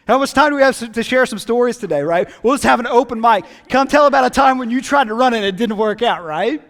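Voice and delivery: fervent preacher growing to a loud, high call; preaching at full force. Acted. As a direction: build each line like a pulpit climax.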